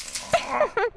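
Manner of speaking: short high wicked cackle. Acted. Hostess gloating over wounded Bruce, high cackle. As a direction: cackle with relish. Hostess before her cure.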